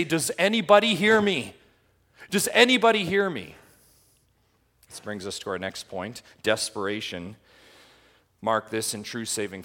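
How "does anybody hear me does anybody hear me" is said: high, loud cry for help; desperate call. Retold or imitated. Preacher voicing a lost person crying out to be heard, high and loud. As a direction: call out like no one is listening. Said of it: "you might say".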